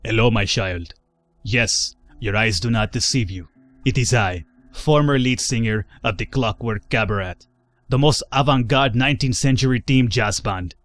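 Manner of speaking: a lightly put-on Finnish accent; grand old singer in a mock Finnish accent. Kaggle introduces himself to the player in a mock Finnish accent. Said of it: "I don't think that's how Finnish people sound".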